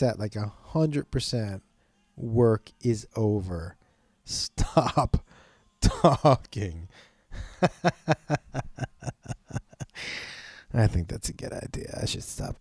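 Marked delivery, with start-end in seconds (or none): 2.2-6.9 very slow, drawn-out order to stop; stretched-out, worn-out refusal; fed-up brain talking. Acted. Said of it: "it just sits there like". 7.3-10.4 long voiceless chuckle in even bursts; soft rhythmic snicker; long laugh. Real, not acted. Laughs after mocking own brain.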